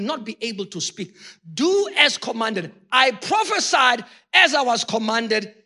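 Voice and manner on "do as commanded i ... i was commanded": preaching shout. Acted. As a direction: proclaim it to the whole church. Preaching climax.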